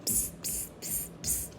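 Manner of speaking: soft unvoiced psst hisses; monkey psst sounds. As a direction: hiss back like a cheeky monkey. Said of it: "the monkeys ... said".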